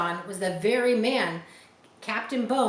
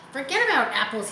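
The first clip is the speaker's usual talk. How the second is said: a touch of pirate bluster; 5 semitones higher, 5 dB louder, faster than usual. A narrator's reading. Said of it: "he said".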